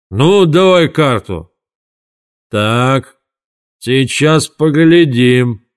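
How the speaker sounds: slow, drawn-out bitter grumble; grumbling ranter. A narrator's reading. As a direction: drag the words out like an aggrieved drunk. Mr Dark rants at fortune-teller machine.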